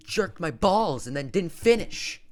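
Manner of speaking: yelling at the screen, disgusted; furious outburst. Real, not acted. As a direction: vent real betrayal at the film. Ranting at the movie's letdown.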